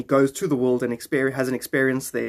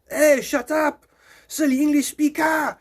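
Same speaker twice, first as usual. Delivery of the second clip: put-on high, loud French accent insult; hammed-up French accent; 12 semitones higher, 4 dB louder than usual. Mock French local yelling at English speaker, French accent. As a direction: yell it like a cartoon Frenchman.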